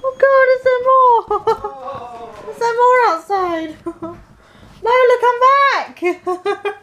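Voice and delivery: high cooing pet voice; high sympathetic coo. Real, not acted. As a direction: coo at a frightened puppy. Soothing frightened dog.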